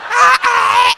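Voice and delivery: high, loud hammed-up sob; mock wailing. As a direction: fake a dramatic wail for an audience. Mock crying after social media post.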